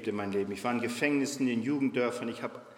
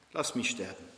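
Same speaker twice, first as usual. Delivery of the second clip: quiet despair; 5 semitones lower, 4 dB quieter than usual. A narrator's reading. Retelling Elijah's words quietly.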